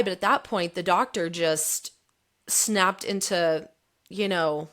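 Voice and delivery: normal talking voice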